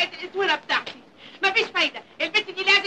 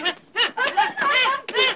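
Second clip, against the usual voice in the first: slow, high, taunting laughter; mocking laugh; 9 semitones higher, much slower than usual. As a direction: cackle over her victim. Mistress mocking the beaten maid. (ضحكة شماتة ساخرة)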